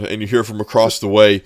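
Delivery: ordinary talk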